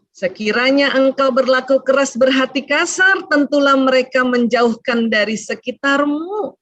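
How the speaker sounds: ordinary delivery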